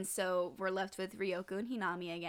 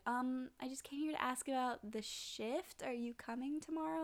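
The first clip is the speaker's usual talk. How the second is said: higher, softer, tentative question; tentative asking; 4 semitones higher, 7 dB quieter than usual. Retold or imitated. Boy checking on a friend in bed, tentative. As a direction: ask gently at a raised pitch.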